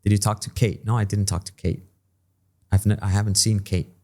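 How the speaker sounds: "Kate" cut off with no T released; stop T Kate. Shown as a demonstration. Teacher drills the stop T in a dialogue. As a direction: stop the air at the end of "Kate". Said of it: "interrumpimos el aire".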